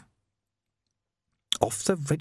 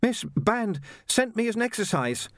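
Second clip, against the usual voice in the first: put-on much higher, polite young Jocasta, a touch breathless; young woman voiced; 11 semitones higher than usual. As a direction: play a well-mannered girl treading water. Jocasta answers.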